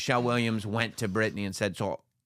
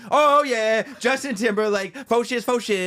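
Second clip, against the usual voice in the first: very high, loud hammed-up fan greeting; mock fan; 11 semitones higher, 5 dB louder than usual. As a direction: gush like a starstruck fan. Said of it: "be like".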